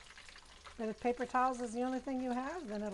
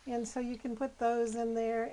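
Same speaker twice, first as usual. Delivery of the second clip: ordinary delivery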